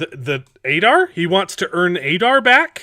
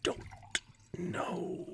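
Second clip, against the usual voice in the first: hushed whisper; 18 dB quieter, much slower than usual. Real, not acted. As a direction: breathe it, barely voiced. Whispered aside while watching.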